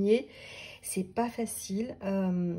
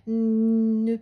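a light mock warning voice, louder on "ne"; intuition's warning; 2 semitones higher, 5 dB louder than usual. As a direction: voice the intuition as a gentle alert. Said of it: "je sens que c'est en train de me dire". (avertissement de l'intuition, légèrement joué)

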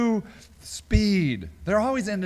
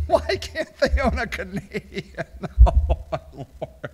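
punchline squeezed out through real laughter; cracking up. Real, not acted. Host mocking Google's response, cracking up. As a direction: lose it on the joke and sigh out the end.

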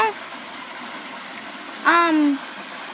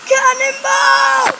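cannonball yell; 10 semitones higher, 4 dB louder, slower than usual. Real, not acted. Jumping into pool, calling out.

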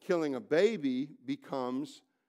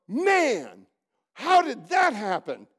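higher, louder, slow baffled cry; mock baffled; 8 semitones higher, 11 dB louder, much slower than usual. Preacher mimics a bitten man, loud and baffled. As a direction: play the dumbfounded fool who got bitten.

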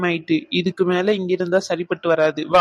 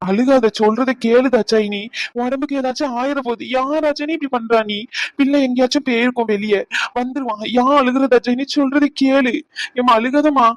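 higher-pitched, exasperated consoling; urgent hushing; 4 semitones higher than usual. Acted. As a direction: scold a weeping wife into calming down. Husband hushing his crying wife. (பதட்டமாக அதட்டி ஆறுதல்)

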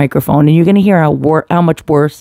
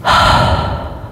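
long breathy sigh into the mic; deep sigh. Shown as a demonstration. Calming nerves before reading, deep sigh. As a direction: let out a slow deep breath. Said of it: "Deep breath".

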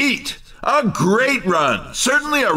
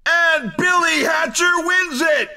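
excited winner call; 7 semitones higher than usual. Acted. Announcer calling the win.

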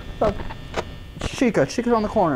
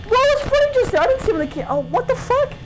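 shrill-pitched, rushed disbelief; panicked high squeal. Real, not acted. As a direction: voice jumps up in alarm. Panic as animatronic appears.

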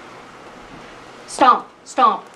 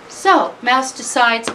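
plain reading voice

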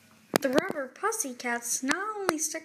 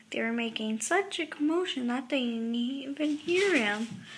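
ordinary delivery

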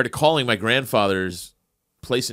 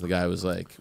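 ordinary talk

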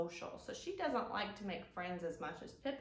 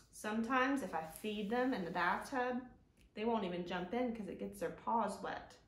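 normal talking voice

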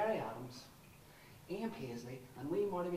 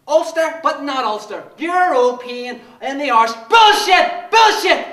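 much louder, higher barking shout; furious rant; 9 semitones higher, 22 dB louder than usual. Acted. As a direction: spit each word with rage.

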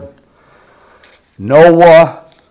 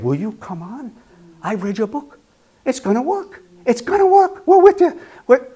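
high, climbing, the angel's cheer; voiced cheering angel; 10 semitones higher than usual. The angel urging the believer on, high and excited. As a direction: rise higher with each line, excited.

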